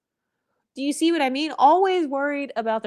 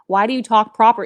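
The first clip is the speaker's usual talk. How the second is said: louder mock replay of "proper"; mocking retort; 7 dB louder than usual. Vlogger mocking someone who asked about her speech, louder. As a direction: throw the question back with scorn. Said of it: "I was once asked".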